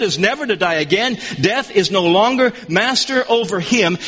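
normal talking voice

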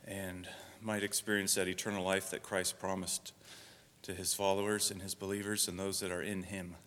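hushed, reverent pastoral prayer; quiet opening prayer from the pulpit. Acted. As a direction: pray it softly to God, unhurried. Opening prayer before the sermon.

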